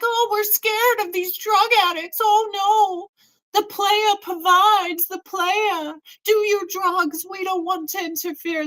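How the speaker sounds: high-pitched, sing-song mocking voice; hammed-up helpless cop impression; mock scared cops.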